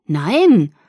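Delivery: loud, indignant no; protesting. A narrator's reading. Boy refuses to be thrown out, protesting. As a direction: protest in one loud burst. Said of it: "protestierte er".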